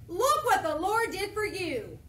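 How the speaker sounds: mock proud messengers; proud boast. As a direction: show off the trophy proudly. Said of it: "they're like".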